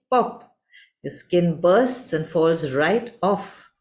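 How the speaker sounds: ordinary delivery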